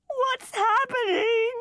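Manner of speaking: high, loud, slow wail; mother wailing in panic for her child; frightened wail. Acted. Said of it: "wailed Missus Seven Eight Six X".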